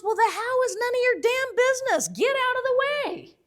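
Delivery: very high, loud mock tirade; acted scolding. Terrifying boss scolding her intern, high imitated outburst.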